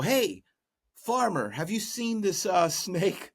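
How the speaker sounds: high-pitched hunters hailing a farmer; calling out. Acted. Hunters question a farmer about a snake, calling out high. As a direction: call over to him, voice lifted well up.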